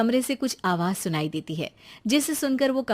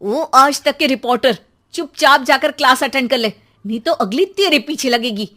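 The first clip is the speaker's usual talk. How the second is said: scolding mother; 3 semitones higher, 8 dB louder than usual. Acted. Mother threatens son.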